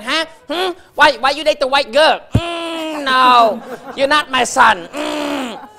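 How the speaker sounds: put-on high, loud scolding mother voice, accented; mock heart-attack mom yelling high and loud. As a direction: shout it like an outraged immigrant mom disowning her son. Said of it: "They're like".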